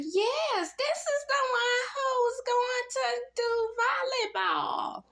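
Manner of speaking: very high, slowed, squealing delight ending in a shout; imitating Melania, shrieking high with over-the-top glee; mock gushing squeal. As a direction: gush it like a giddy superfan picking her star. Mimics Melania gushing on arrival.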